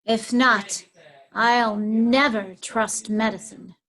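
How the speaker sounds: slow, slightly softened aside. Acted. Poisoner to herself about the sick woman, aside. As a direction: say it slowly, as an aside to yourself.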